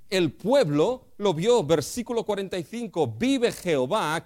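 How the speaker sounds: loud, high, drawn-out preaching; preacher building to full force; fiery preaching. Acted. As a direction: drive the point home from the pulpit.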